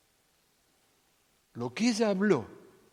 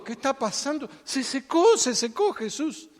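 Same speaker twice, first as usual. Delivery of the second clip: discípulos emocionados; 8 semitones higher, 4 dB louder than usual. Acted. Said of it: "Estaban súper emocionados".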